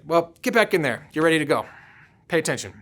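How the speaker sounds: brushing it off like nothing happened; offhand coach. Retold or imitated. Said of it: "But he's like".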